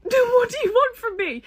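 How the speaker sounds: high, loud, desperate cry while running; mock-terrified shout over the shoulder; panicked yell. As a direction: yell it back at the car in a panic. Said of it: "and I was like".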